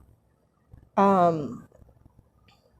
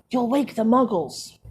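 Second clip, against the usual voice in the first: hissed warning; 2 semitones higher than usual. A narrator's reading. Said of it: "hissed Professor McGonagall".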